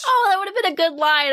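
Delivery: normal talking voice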